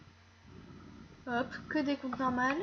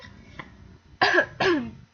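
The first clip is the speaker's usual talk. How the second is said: toux forte et étranglée; quinte de toux; 4 semitones higher, 10 dB louder than usual. Real, not acted. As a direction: tousse en s'étouffant. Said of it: "je m'étouffe". (toux d'étouffement)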